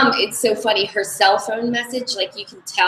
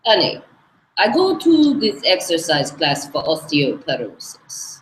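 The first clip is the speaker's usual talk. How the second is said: put-on retelling an exercise-class complaint in a Hungarian accent; Aggie's Hungarian accent; 4 semitones higher than usual. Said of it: "one more quick Aggie impression".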